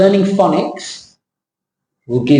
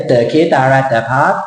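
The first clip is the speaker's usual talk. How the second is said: Thai accent going up and up towards the end. Shown as a demonstration. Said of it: "Thai accent go up and up". (สำเนียงไทย เสียงสูงขึ้นท้ายประโยค)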